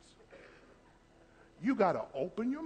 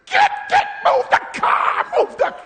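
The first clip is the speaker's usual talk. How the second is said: high, loud panicked shouting; screaming in pain; 14 dB louder, slower than usual. Retold or imitated. Someone's toe run over by a car, screaming. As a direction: yell at the driver in agony. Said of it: "How you gonna act".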